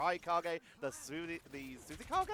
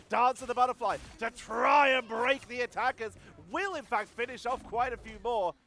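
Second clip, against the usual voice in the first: higher, louder excited caster; live esports play-by-play; 5 semitones higher, 6 dB louder than usual. Real, not acted. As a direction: jump urgently into the action.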